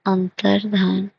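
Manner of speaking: अंतर्धान said slowly, syllable by syllable; spelling it out slowly. Shown as a demonstration. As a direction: spell out अंतर्धान carefully while writing. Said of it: "ऐसा लिखा जाता है". (अंतर्धान धीरे-धीरे, एक-एक अक्षर बोलकर)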